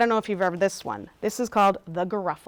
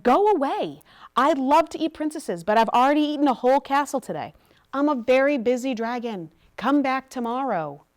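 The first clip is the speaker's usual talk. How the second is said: louder, higher dragon sending her away; annoyed dragon; 4 semitones higher, 6 dB louder than usual. A narrator's reading. Dragon to princess knocking a second time, loudly dismissive. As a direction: play a busy dragon who wants to be left alone.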